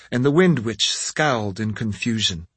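plain reading voice

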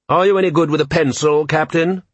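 a touch of feigned innocence; innocent Vetinari. A narrator's reading. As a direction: ask it all wide-eyed innocence. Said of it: "said Lord Vetinari innocently".

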